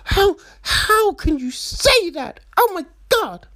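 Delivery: imitating a sweet girl raising her voice. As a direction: pipe up in mock outrage. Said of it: "they might raise their voice and be like".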